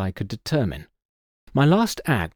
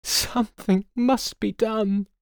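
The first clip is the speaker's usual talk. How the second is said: desperate plea; 11 semitones higher, slower than usual. Acted. Distraught daughter.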